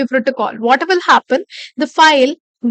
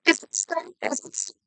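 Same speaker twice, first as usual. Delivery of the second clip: breaking up; 12 semitones lower, 10 dB quieter than usual. Real, not acted. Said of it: "is my voice cracking?".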